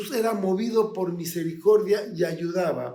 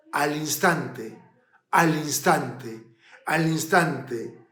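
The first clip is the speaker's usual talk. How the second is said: slow emphatic repetition; 3 semitones lower, slower than usual. Real, not acted. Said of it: "repeated for emphasis".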